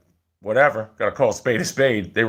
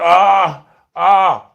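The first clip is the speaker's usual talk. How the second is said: mock pained "ah", higher and louder; fake groan of pain; 6 semitones higher, 5 dB louder than usual. Worker lying down on the roof, faking a hurt groan. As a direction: ham up a hurt groan to skip work. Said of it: "acting like I was hurt".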